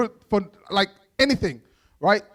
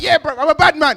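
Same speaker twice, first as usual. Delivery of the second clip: loud, higher-pitched mock bad-man boast; mock street bravado; cocky youth bravado; 5 semitones higher, 6 dB louder than usual. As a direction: swagger like a kid with dad out of earshot. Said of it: "As soon as your dad goes out, you're like".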